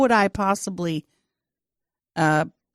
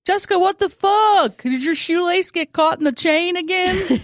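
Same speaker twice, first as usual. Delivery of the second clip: loud teasing; 12 semitones higher, 3 dB louder than usual. Retold or imitated. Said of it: "they would have been like".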